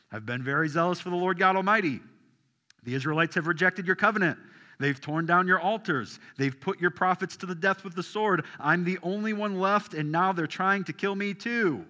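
loud, high, fast protest; Elijah's loud complaint. Acted. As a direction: pour out the complaint in a rush. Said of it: "And he replies".

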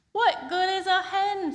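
high, loud, exasperated question; exasperated Peter. Acted. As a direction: squeak in disbelief. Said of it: "said Peter".

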